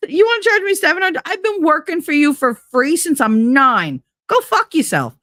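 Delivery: high, fast indignant rant; retold outburst. Retelling telling off the man who charged her, indignant. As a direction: let rip at the greedy relative.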